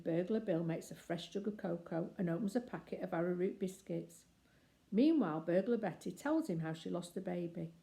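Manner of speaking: ordinary delivery, nothing marked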